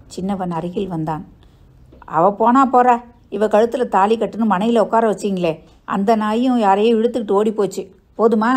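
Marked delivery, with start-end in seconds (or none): 2.0-8.5 raised, angry revelation; bitter outburst. Acted. Son telling family the bride eloped, bitter outburst. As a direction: throw the news at them angrily.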